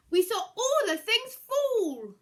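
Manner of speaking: high, loud, slow narration; dramatic crash. A narrator's reading. Narrator telling how everything crashed down, slow and loud. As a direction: draw out the big fall.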